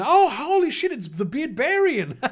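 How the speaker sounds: high, delighted outburst; light up at spotting a friend; excited surprise. Real, not acted. Spots a known viewer in chat.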